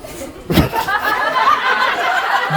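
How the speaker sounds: breathy high-pitched shriek of laughter. Real, not acted. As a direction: squeal with laughter. Laughing at own writing.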